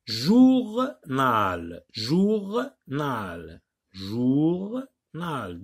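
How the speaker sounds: "Journal" broken into jour, nal; split into syllables. Shown as a demonstration. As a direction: draw out each syllable of "Journal" with a gap. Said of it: "des mots en syllabes".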